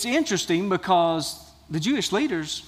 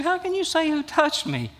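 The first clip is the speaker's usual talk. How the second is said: much higher-pitched, mildly incredulous quote; incredulous question; 8 semitones higher than usual. Acted. Disciples questioning Jesus in the crowd, mildly incredulous. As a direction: read it as a high, puzzled challenge.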